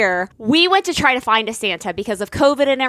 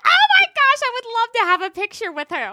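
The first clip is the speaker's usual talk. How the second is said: high, fast gush of delight; excited gushing; 9 semitones higher than usual. Retold or imitated. Woman offered a picture with Missus Claus, re-enacted excited squeal. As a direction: squeal it like a thrilled fan, then rush on. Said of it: "I was so excited".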